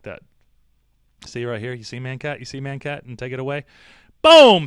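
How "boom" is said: loud boom. Real, not acted. Reveal of picture.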